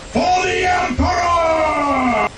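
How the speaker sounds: high, drawn-out battle roar, imitating marines; screaming space marine. Screaming space marines yelling their war cry, full-blast yell. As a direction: bellow it long like a charging marine choir. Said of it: "screaming space marines yelling".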